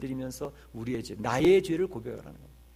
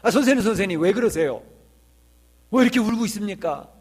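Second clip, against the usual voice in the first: loud, high startled questioning; 7 semitones higher, 10 dB louder than usual. Retold or imitated. Witness finds the composer weeping, cries out startled. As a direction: call out in shock and concern. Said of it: "깜짝 놀래가지고".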